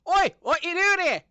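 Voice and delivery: high, loud cheeky British bunny; British rabbit voice. Acted. British accent, rabbit confronting the adventurers. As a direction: squeak it out like a mouthy British rabbit. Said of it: "Oh no, I went British".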